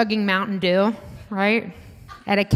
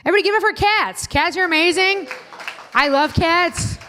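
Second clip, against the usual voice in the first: higher, louder crowd hype; excited hype; 10 semitones higher, 4 dB louder than usual. Real, not acted. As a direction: pump up the room for cats.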